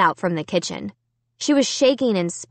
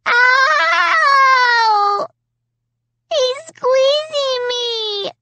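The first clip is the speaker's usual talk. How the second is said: long, very high cries of pain; drawn-out high-pitched bawling; wailing little girl; 4 dB louder, much slower than usual. A narrator's reading. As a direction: howl like a hurt small child. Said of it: "Alicia wailed".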